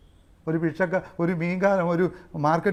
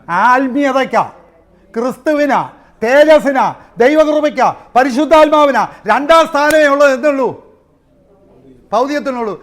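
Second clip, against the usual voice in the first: each word rising louder and higher; building preaching cry; 5 semitones higher, 8 dB louder than usual. Acted. Preacher building a list to the church, rising to a shout.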